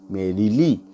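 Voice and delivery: "Merrily" sounded out in even pieces, rising at the end; syllables felt out. Shown as a demonstration. Teacher helping students feel the foot, merrily sounded out. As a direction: say merrily bit by bit, lifting the end. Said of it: "बोलते बोलते समझ में आएगा".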